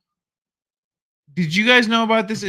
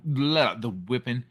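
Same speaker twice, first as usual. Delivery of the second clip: ordinary delivery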